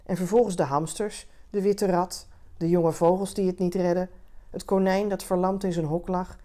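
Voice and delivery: ordinary delivery, nothing marked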